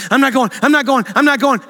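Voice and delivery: high, loud panicked pleading, imitating the fiancée; panicked refusal. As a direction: squeal in terror at the plane door. Retelling the fiancée's video panic.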